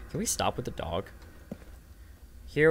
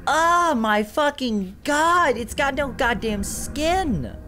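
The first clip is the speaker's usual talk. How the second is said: much higher, slow, disgusted and scared; real horrified shriek; 14 semitones higher, slower than usual. Real, not acted. Facing the skinless monster in the game, high horrified shrieking. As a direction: recoil from something grotesque.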